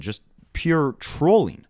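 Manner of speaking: usual speaking voice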